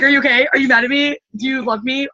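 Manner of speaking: playfully hammed-up worry; mock panicky. Retelling her anxious reply to Anna's text, mock panicky. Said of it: "I'm like".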